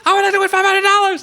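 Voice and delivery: very high, full-on mock scream; hammed-up shrieked answer; yelling. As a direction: scream it back as the shouting match peaks. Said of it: "they're just yelling at each other".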